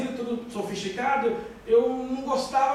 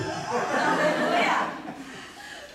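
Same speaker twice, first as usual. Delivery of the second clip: high laugh. Real, not acted. Preacher laughing as he tells the church his story. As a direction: burst of high breathy laughter.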